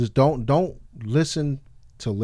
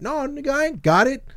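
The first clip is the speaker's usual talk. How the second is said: indignant retort; 14 semitones higher than usual. Real, not acted. Retort to the freeloader.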